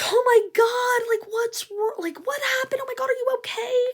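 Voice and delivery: high, gushing concern; passerby fussing over the crash girl; worried bystander. Retold or imitated.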